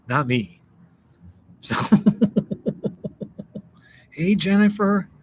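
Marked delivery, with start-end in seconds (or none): 1.8-3.6 long, breathy run of high chuckles; real chuckle. Real, not acted. Streamer laughing after saying only viewers have accents, chuckling. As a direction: laugh it out in airy pulses.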